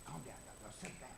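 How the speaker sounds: hushed scolding. Retold or imitated. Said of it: "didn't do a lot of".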